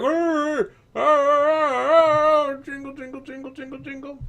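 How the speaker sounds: high muffled grunts through a gag. Acted. Gagged prisoner grunts through gag.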